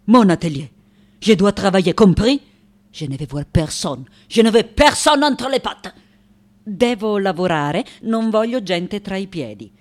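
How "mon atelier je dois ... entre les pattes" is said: loud, forceful insistence; loud, annoyed outbursts; irritated. Acted. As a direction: defend your studio crossly. Artist defends studio from intruders.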